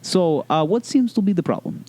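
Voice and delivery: put-on the kind Russian urologist in a Russian accent asking politely. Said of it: "he's a friendly Russian".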